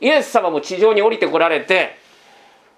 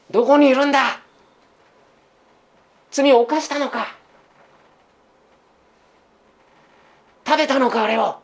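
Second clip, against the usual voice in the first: compassionate questioning, pitched higher; pitying voice; 6 semitones higher than usual. Retold or imitated. God seeking frightened Adam in the garden, pitying. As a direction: gently ask as if grieving for him. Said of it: "哀れむ声ですよ".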